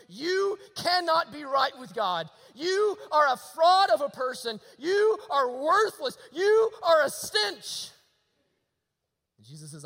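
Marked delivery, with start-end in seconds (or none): 0.1-7.9 loud, slow accusing shout, rising higher; accusing past. Acted. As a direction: hurl each charge slowly like a scolding voice. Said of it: "it wags its finger at you and it tells you".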